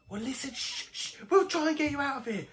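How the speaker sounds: soft, high, hurried voice; urgent high-pitched near-whisper; hushing rescuer. Acted. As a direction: hush a friend and promise rescue. Rescuer hushes captive friend.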